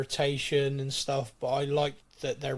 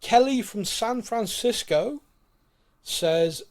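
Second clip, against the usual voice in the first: announcer voice; 7 semitones higher, 4 dB louder, slower than usual. Acted. A listener's question, announced grandly.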